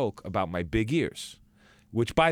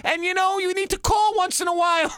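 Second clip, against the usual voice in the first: very high, louder, pleading mom voice; 6 dB louder than usual. Retold or imitated. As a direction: let the mother's guilt trip pour out, pitched way up. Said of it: "I said".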